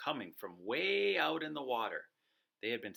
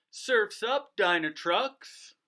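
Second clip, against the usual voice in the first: high, loud, slow cheer; cheering the trucks to surf; excited cheer; 8 semitones higher, 7 dB louder, slower than usual. Acted. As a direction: call everyone to the waves.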